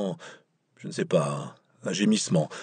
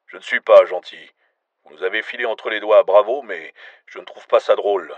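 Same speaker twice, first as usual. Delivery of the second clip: louder, hard-edged agent voice; not nice; 8 dB louder than usual. A narrator's reading. American agent scolding Myron for losing his tail, loud and cold. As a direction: lay down the law, cold and annoyed. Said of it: "vous n'avez pas l'air très gentil".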